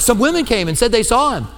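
a mocking aside that brushes off the women's testimony; a scoffing disciple waving off the news; dismissive throw-in. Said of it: "That's how they throw it in".

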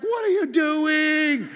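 loud, high, drawn-out shout; hammed-up bellow of outrage, words stretched slow; mad yell. Competitive shopper at a held-up checkout line, hammed-up mad yell. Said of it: "I get mad".

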